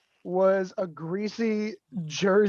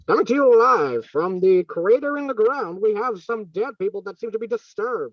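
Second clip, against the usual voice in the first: higher, louder field reporter through a cup; radio announcer; 4 semitones higher, 5 dB louder than usual. Acted. Player doing a live news report through a cup. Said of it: "wait, wait, hold on".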